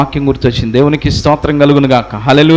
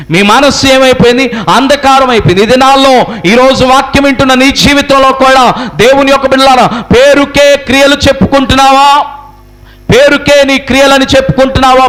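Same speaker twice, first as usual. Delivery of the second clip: high, sustained sermon cry; preaching at full force; 7 semitones higher than usual. Real, not acted. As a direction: declaim it to the congregation in long high-pitched phrases. (గట్టి స్వరంతో ప్రసంగం)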